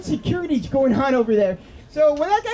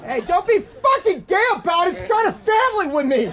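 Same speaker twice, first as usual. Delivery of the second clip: mock indignant; 4 semitones higher than usual. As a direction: play offended, then plead.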